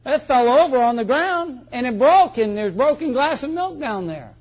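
put-on high-pitched innocent kid dodging blame; child making excuses. Child explains spilt milk to dad, high-pitched self-protecting excuse. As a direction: voice a child reporting an accident as nobody's fault.

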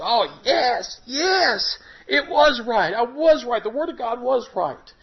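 high, choked-up joyful affirmation; tearful joy. Real, not acted. Testifying to the church, overcome with tearful joy. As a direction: let joy break through the tears. Recalls answers found, overcome.

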